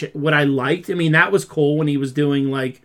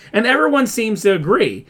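ordinary delivery

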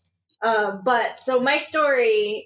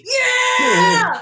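mock ecstatic shout, higher and louder; imitating uncle's wild yell; 6 dB louder, much slower than usual. Uncle hears niece got into college, yelling wildly. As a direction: scream it like a fan losing his mind. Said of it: "he just goes".

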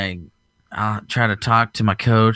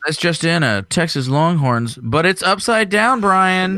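mock news anchor; 9 semitones higher, 4 dB louder than usual. Mock news banter, high voice.

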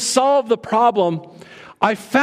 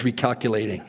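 flat, lower robotic nav voice; GPS voice; 10 semitones lower, 6 dB quieter, faster than usual. Retold or imitated. Mocking the phone's GPS voice, robotic and flat. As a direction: drone it like a GPS. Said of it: "when I hear the words".

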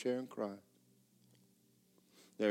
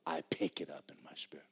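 ordinary talk, nothing marked

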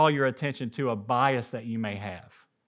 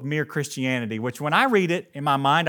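usual speaking voice

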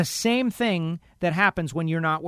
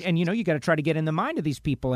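normal talking voice

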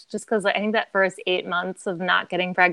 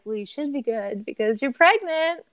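higher, bright, smiling announcement; cheerful; 6 semitones higher than usual. Retold or imitated. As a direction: deliver the news happily. Nurse quoted delivering news.

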